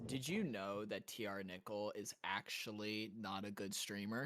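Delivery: quiet deadpan lie; softly fib with a straight face; deadpan fake lie. Shown as a demonstration. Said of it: "check this out".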